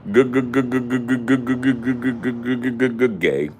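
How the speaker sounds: machine-gun the g up high; mock stutter, high and loud.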